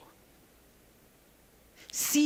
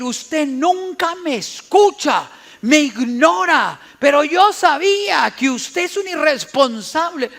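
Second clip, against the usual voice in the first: accusing wife; 4 semitones higher, 7 dB louder than usual. Retold or imitated. Hurt wife scolds her husband for forgetting, accusing. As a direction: hurl the blame at him.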